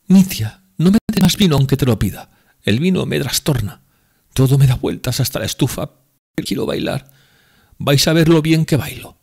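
high, loud tipsy woman pleading; drunk Grushenka. Acted. Drunken Grushenka wants to dance.

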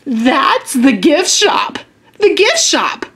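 put-on mildly excited little girl; a touch of happy discovery; delighted guess, lightly acted; real delighted. A narrator's reading. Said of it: "real delighted".

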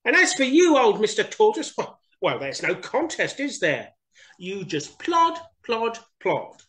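high teasing hare voice; mocking Hare. Hare mocking Tortoise, high and teasing. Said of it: "boasted Hare".

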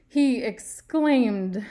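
drawn out slowly and loudly, mocking the fancy word; hammed-up exclaimed. Teacher mocking writers who overuse fancy tags, slow and loud. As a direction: stretch it out, poking fun at the fancy tag.

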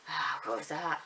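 normal talking voice

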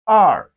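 "R" loud, dropping steeply in pitch; falling tone. Shown as a demonstration. Teacher models Chinese two for learners, loud falling "R". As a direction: hit "R" hard and let it fall. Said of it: "Falling tone".

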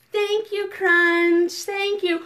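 bright-eyed shout-out to a storybook friend; enthusiastic thanks. Pretend. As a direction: call out an eager thank-you, a bit too loud. Group reply, told to say thanks.

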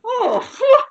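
high, shouted phew of relief; relieved shout. Real, not acted. Relief after exiting the bit.

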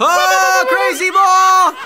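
high, loud, slowly stretched holler; excited shout. Real, not acted. Player throwing a special pitch, excited shout. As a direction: announce the pitch with a long yell.

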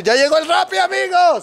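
high, loud hype for the camera; excited announcement. Real, not acted. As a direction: shout it to the viewers like a host. Announcing delivery to viewers.

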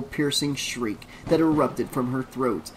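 ordinary delivery, nothing marked

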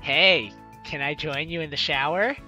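ordinary talk

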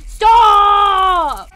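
long, high, very loud yell; full shout. Real, not acted. Gamer ordering game humans to halt, drawn-out shout. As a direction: bellow it out and hold the vowel. Yelling at fleeing game characters.

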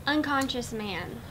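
usual speaking voice

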